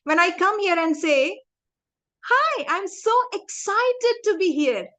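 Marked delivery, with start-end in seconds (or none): 2.2-4.9 smiling, excited. Shown as a demonstration. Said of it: "when I smile and say that".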